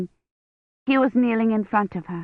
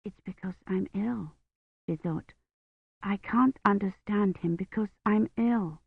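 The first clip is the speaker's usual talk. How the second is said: inner thought; 7 dB quieter than usual. Acted. She thought, feverish.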